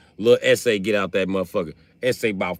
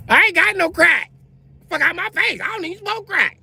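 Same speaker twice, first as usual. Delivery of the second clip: much higher, loud defiant woman's voice; 4 dB louder than usual. Retold or imitated. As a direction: deny it loudly to the cops. Prostitute denies to cops.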